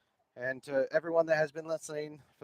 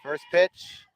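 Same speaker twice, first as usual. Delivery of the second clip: play-by-play; 3 semitones higher, 6 dB louder than usual. Acted. Live softball play-by-play.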